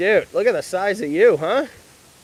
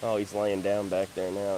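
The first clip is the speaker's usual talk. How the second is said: normal talking voice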